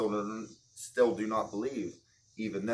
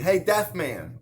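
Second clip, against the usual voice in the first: loud higher hail; calling out; 6 semitones higher, 7 dB louder, slower than usual. Pretend. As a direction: shout to get his attention. Playing Jesus calling deaf man.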